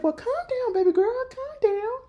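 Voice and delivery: put-on teasing high coo to an angry girl; mock soothing. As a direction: coo at her to settle. Narrator soothing Juke mockingly.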